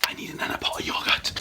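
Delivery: hushed whisper. Real, not acted. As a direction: whisper so he can't hear. Hiding from Elliot on roof.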